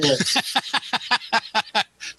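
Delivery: sudden amused laughter; a short burst of real laughing; hearty laugh. Real, not acted. Laughing at masked man joke.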